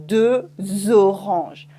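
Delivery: "deux" slides into "oranges" with a Z; Z liaison. Shown as a demonstration. Teacher stresses the Z in "deux oranges". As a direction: link with a clear Z. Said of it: "I make the Z sound".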